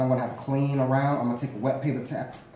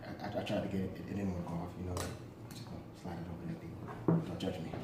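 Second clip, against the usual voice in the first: hushed apology; 7 semitones lower, 15 dB quieter, faster than usual. Real, not acted. Apologizing to viewers.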